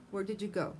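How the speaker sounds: WH question falling on "go"; falling intonation. Shown as a demonstration. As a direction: let "go" drop at the end, finishing the question downward. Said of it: "Falling intonation".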